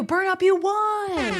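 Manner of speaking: slow, high and loud winner call; excited announcement. Real, not acted. Telling Burn Up they won the race, a high, slow, loud call. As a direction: announce the winner like a big reveal.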